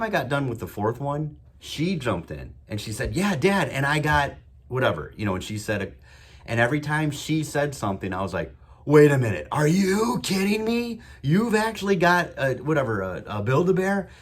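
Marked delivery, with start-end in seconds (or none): 3.1-5.1 excited daughter. Retold or imitated. Daughter joins the game. 8.8-14.1 goofy loud excitement. Retold or imitated. Dad hyping up his moody daughter in the car, loud goofy disbelief. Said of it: "real goofy".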